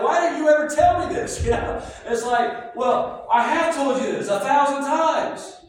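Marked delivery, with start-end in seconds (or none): 0.0-1.4 high, loud mock protest; raised voice, as if only just finding out; incredulous demand. Acting out a belated realisation. 2.8-5.6 loud, high mock exasperation; acted-out protest at being ignored; exasperated retort. Acting out an exasperated reply.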